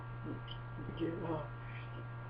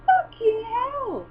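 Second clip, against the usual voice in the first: drawn-out, high, astonished curse; amazed swear; 3 dB louder, much slower than usual. Real, not acted. Watching the pigeon's display flight, slow amazed swear. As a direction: stretch it out in real awe.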